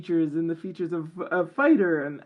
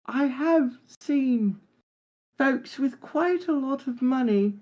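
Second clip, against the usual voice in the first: higher voice, a lightly voiced Cockney-posh elf blend; Farthing's voice; 6 semitones higher than usual. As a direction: answer in character as the scrappy elf rogue. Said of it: "Farthing, do you think money can buy happiness?".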